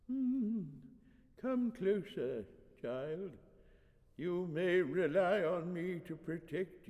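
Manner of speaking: put-on doting old priest. Old priest beckoning the girl closer, doting dodder. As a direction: coax as a doddering elder.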